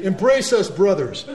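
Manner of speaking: high, loud, hammed-up plea of brotherhood; mock earnest embrace; mock hippie plea. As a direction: ham up a naive peace activist. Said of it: "go to the V F W and say".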